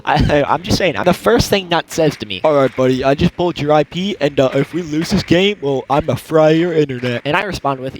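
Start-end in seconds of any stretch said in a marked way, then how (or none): 2.4-7.2 lightly imitated slurry pothead; mock a stoner gamer making a threat; a hint of generic slurry pothead. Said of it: "just pothead voices like you've ever heard".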